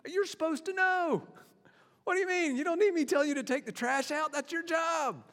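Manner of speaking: put-on a parent fed up with a teenager; exasperated parent. Parent scolding teenager over the trash, exasperated.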